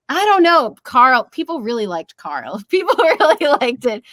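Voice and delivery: usual speaking voice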